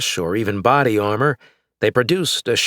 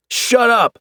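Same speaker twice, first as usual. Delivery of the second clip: loud, much higher furious roar; 14 semitones higher, 5 dB louder than usual. Acted. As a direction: explode at him. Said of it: "the man roared".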